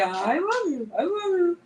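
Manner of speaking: high doggy croon; talking dog. Shown as a demonstration. Owner telling her dog what to say, high doggy voice. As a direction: model it high for the dog to copy.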